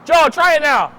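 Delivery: high, loud call to a coworker; calling out loudly. Acted. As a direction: shout the line across the room.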